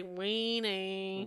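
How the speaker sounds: put-on small nasally child saying it; nasal little kid voice. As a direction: pinch it through the nose, childlike. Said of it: "nasally voice".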